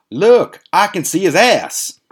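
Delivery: very high, loud, drawn-out yell; Newfoundland-accent scream. Retold or imitated. Friend screams out at the open bedroom door, mock Newfoundland shout. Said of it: "And Noof screams out".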